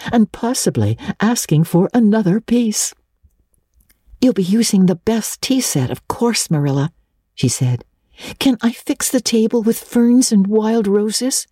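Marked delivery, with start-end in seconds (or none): 4.2-6.9 Anne. A narrator's reading. Anne's voice in the story. 8.4-11.5 Anne. A narrator's reading. Anne's eager question.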